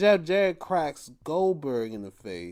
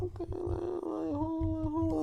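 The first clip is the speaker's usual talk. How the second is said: mock high voice; 11 semitones higher, 7 dB quieter than usual. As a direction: squeak it out like a startled guy. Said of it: "I'm like".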